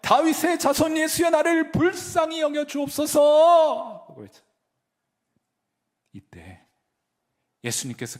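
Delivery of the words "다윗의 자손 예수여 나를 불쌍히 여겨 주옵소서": very high, loud, slow desperate shout; louder cry. Retold or imitated. Blind man shouting louder to Jesus for mercy. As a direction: shout it over everyone, begging. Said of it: "더 목소리를 높여 외칩니다".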